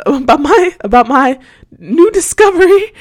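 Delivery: high, loud words breaking into laughter; embarrassed laughing. Real, not acted. Host realizing all her listeners now know, laughing. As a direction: laugh through the confession, pitch climbing.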